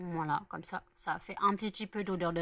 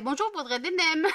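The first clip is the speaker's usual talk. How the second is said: higher, louder mock customer order; 8 semitones higher, 4 dB louder than usual. Imagining her friend ordering at the restaurant, a higher mock voice. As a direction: play the friend ordering nems.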